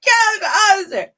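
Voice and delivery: put-on comic self-parody of a crying teen; mock wail. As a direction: overplay a tearful cry for help, high and loud. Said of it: "I'm like".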